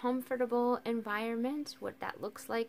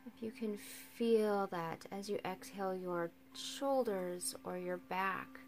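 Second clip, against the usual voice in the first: calm, unhurried guiding with long silences; guided meditation; 4 semitones higher, 8 dB quieter than usual. Acted. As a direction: ease listeners into rest, leaving space for each breath.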